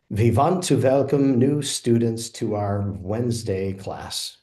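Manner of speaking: "We want to welcome" as "Vee vant to velcome"; first W's turned to V, "Wednesday" nearly right; W's like V's. Shown as a demonstration. Learner repeating after the guide, "We want", "welcome" said with V sounds. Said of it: "I struggled with the first few W's".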